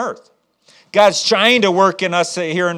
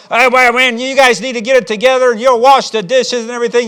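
put-on high, loud rant at the kids; yelling; 6 semitones higher, 5 dB louder than usual. Parent ordering kids to do chores, yelling. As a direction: play a fed-up parent hollering. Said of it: "you're, you're yelling".

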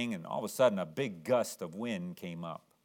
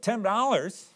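put-on much higher and louder, pleading child; upset boy; 12 semitones higher, 10 dB louder than usual. Boy protesting the shopkeeper's price, high pleading cry. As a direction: squeal it like a boy losing his boat.

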